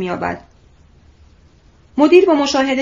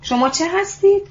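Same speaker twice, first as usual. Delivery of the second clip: higher-pitched, surprised question; taken aback, voice raised in pitch; astonished question; 5 semitones higher than usual. A narrator's reading. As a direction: ask it in amazement, pitch lifting. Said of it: "با حیرت پرسید".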